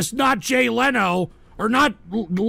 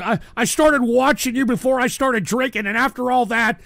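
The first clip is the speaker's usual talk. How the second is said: ordinary delivery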